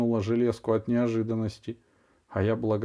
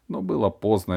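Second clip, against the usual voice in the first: plain reading voice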